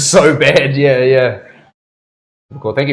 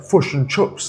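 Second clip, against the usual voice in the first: put-on Kiwi accent; 3 semitones higher, slower than usual.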